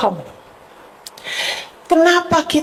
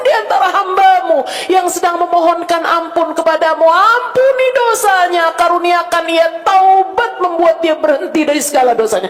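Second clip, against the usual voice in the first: pleading prayer; 7 semitones higher than usual. Acted. Preacher praying aloud for a sinner, pleading. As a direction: beg forgiveness in prayer.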